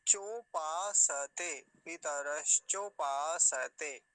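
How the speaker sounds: चोपासते very slow, long cho, paa, full sa; syllable by syllable. Shown as a demonstration. Teacher joining the parts, slow stretched syllables. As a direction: stretch पितरश चोपासते part by part. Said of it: "ऐसा हो जाएगा".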